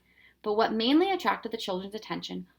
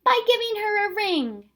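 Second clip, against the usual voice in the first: put-on girl voice; 13 semitones higher, 6 dB louder than usual. Girl character's line in story.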